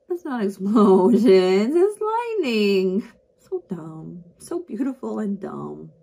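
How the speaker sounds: patient, amused correction drawn out; fond condescension. Acted. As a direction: explain it slowly like to a child.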